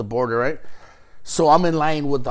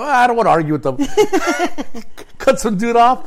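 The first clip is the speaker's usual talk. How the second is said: laughing through it; 8 semitones higher, 5 dB louder than usual. Real, not acted. Laughing while telling it.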